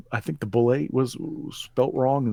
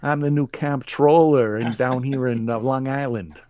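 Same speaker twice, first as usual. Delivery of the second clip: put-on Long Island accent; 3 semitones higher, 4 dB louder than usual. Continues accent joke.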